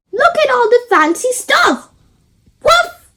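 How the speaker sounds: high, loud excited puppy with a bark. A narrator's reading. Danny the dog marvelling at the palace, high and loud. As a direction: yip it out like an excited little dog. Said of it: "Danny the dog says".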